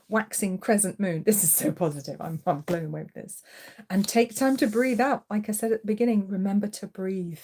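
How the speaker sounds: ordinary talk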